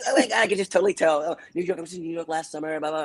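high-pitched, fast mock girly chatter; gushing girls. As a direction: imitate excited girls babbling away. Mimics two gushing girls there.

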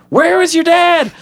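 very high, loud squeaky question; puzzled. Retold or imitated. Viewer wondering about the missing dad, squeaky surprise. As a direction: squeal it in disbelief. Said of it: "And I'm like".

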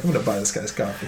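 said through a laugh. Real, not acted. Telling the friend about buying the guy's coffee, through a laugh. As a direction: laugh it out, soft.

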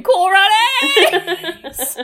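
high, very drawn-out holler; rallying cry. Retold or imitated. Podcaster showing how they leave the house in winter, drawn-out cry. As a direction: holler it long like a silly battle cry. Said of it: "I will run out saying".

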